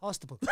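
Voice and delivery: "Hospital" blurted out on request, sounding garbled. Shown as a demonstration. Asked to repeat "Hospital", he tries again. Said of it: "Say it one more time".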